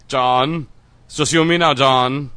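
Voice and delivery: loud villain in a bad Russian accent; atrocious Russian-Finnish accent. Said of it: "Russian via a atrocious Finnish accent".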